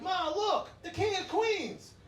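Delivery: high-pitched mock child's call; excited little kid. Little kid spotting a lookalike in bodega, high imitated child. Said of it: "the little kid would be like".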